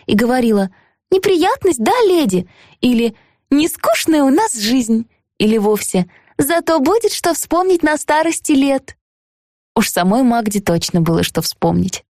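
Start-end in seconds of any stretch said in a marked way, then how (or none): 1.1-9.0 thin ringing maid voice, much higher; high chirpy voice. Retold or imitated. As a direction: chirp it brightly like Magda. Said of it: "тоненьким, звонким голоском".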